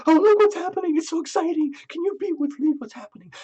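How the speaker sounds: high, breathless, gushing chatter; gushing excitement. Retold or imitated. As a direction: squeal it out like an overexcited friend spilling news. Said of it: "that you don't have to talk about, like".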